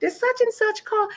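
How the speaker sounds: a sing-song sweet retake of the question; sweetened redo. Retold or imitated. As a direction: ask it again all sweet and high. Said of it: "Let me pull that back and say".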